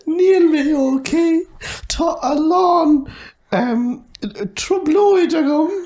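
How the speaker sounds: high put-on schoolgirl voice; acting as Mary. Mary greets Sean in an oral exam skit, high voice. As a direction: play Mary greeting Sean in stilted Irish. Acted Irish exam dialogue.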